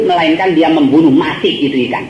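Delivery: ordinary talk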